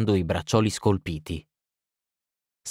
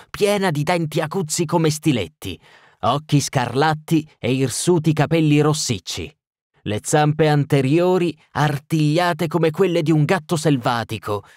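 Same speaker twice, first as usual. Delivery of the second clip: higher, heated shouted description; raging tirade; 6 semitones higher than usual. Acted. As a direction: keep shouting the ugly details. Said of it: "urlò".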